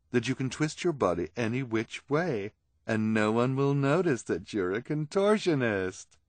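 theatrical villainess coaxing; persuasive schemer. Acted. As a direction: sell it with flourish. Esme sells the robe.

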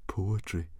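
soft muttered aside. A narrator's reading. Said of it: "muttered Fishlegs".

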